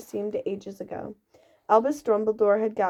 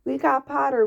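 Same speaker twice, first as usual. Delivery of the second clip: loud, higher cheer; twins yelling; 5 semitones higher, 4 dB louder than usual. A narrator's reading. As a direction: yell it across the hall in triumph. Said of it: "the Weasley twins yelled".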